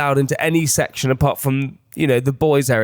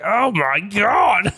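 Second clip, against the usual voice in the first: put-on awed boys; 6 semitones higher, much slower than usual. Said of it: "the boys were just like".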